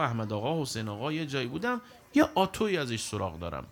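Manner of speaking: normal talking voice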